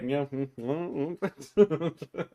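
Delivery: lightly acted non-committal humming; wordless mumbling reply. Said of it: "He's just like".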